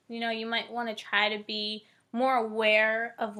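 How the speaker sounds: usual speaking voice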